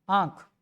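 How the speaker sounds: lightly nasalized आँ, then ख in आँख; light chandrabindu nasal. Shown as a demonstration. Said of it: "जैसे हल्का".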